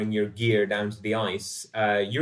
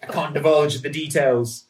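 higher, louder, swaggering ship's officer; rakish sailor William; 7 semitones higher, 6 dB louder, slower than usual. Acted. William leaning on the bulkhead, chatting up Dorothy: high, loud, showy. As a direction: play the smug charmer name-dropping a famous friend. William bragging, then his nerve fails.

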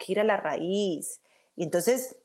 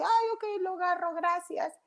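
higher, lightly voiced surprised thanks; retelling her own reply; 11 semitones higher than usual. Taking the bookmark at her kids' school, mock high thanks. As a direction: replay your own startled little thank-you.

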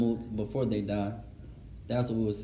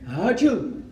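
a quick, higher pretend sneeze; imitated sneeze; 5 semitones higher than usual. Shown as a demonstration. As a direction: act out a sneeze. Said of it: "You're in the prayer".

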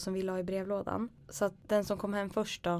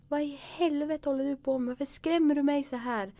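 higher, angry yell at her brother; retold yell; 7 semitones higher than usual. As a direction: lash out at him, half-reliving the fright. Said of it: "skriker jag åt han".

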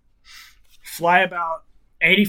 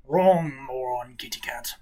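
a lightly voiced old man, slow and a bit lower; old man's voice; 5 semitones lower, 7 dB quieter than usual. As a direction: drag the insult out slowly like an old man. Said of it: "you hear the old man's voice".

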